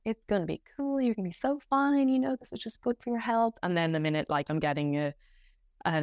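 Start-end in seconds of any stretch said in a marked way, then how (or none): 0.1-3.6 higher, sing-song self-reassurance; reassuring logical brain. Retold or imitated. Her logical brain calming her before an internal exam, higher and sing-song. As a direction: talk yourself down like a calm inner voice. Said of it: "my logical brain is like".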